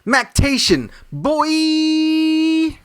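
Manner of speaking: big excited shout of the word; hyped-up pitch. Acted. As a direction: sell it like a hype man. Hyped escalator pitch, calling out.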